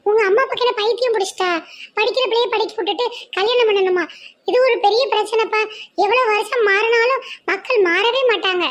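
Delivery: a friend scolding the parents' decision; young woman friend. Acted.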